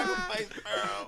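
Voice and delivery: mock crying. Shown as a demonstration. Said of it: "That doesn't even sound right".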